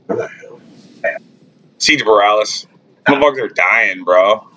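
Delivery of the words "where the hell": under-the-breath whisper. Real, not acted. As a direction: mutter it to yourself. Unvoiced muttered question.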